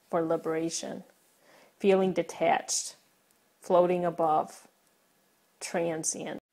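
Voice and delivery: measured, low poetry reading, phrase by phrase; poem recitation. Acted. Poet shares own poem with viewers, measured recitation. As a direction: recite it softly with pauses, fading toward the end.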